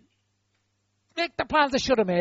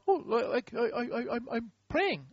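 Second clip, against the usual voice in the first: softer, halting mock stammer; flustered stammer; 2 semitones higher, 6 dB quieter than usual. Joshua explaining himself to God, sheepish stammer. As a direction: stumble over an awkward excuse.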